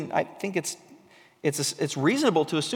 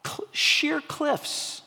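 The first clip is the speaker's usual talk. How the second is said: hushed awe; 10 semitones higher, much slower than usual. Real, not acted. Awed by goats scaling cliffs.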